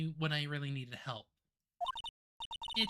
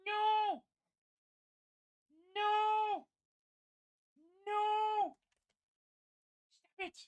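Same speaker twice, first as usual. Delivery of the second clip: panicked squeal; slower than usual. Acted. Character panics at biting dog.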